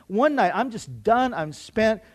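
ordinary delivery

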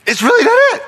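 very high, loud outburst; exclaiming shout. Real, not acted. Preacher driving home relationship with God, high shout. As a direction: cry it out at full pitch. Preacher peaks on his point.

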